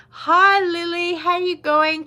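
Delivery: greeting a little viewer, excited; bright greeting. Real, not acted. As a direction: sing out a welcome.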